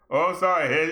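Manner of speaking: ordinary talk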